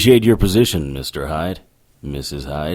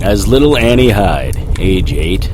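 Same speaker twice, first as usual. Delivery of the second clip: noir narration; 6 semitones lower than usual. A narrator's reading. Narrator voice recounts the fall.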